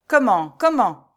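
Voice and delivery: loud, rising outraged double cry; indignant cry. A narrator's reading. Steward crying out in outrage at the girl. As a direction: bristle like an angry rooster. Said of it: "s'écria le majordome en se raidissant".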